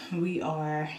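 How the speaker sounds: ordinary delivery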